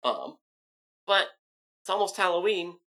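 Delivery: normal talking voice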